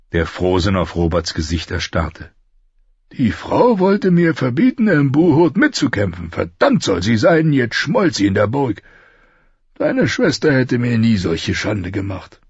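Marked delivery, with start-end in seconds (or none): 3.1-12.4 raised, higher-pitched royal grumbling; indignant King Robert. A narrator's reading. King complaining to Ned about the queen, indignant. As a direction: complain hotly about your wife.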